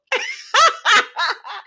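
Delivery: high burst of laughter; real laugh. Real, not acted. Host cracking up at a character's reaction, real laugh.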